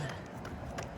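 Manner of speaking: long breathy sigh of resignation; heavy sigh. Real, not acted. Runner sighing before finishing. After choosing to keep going.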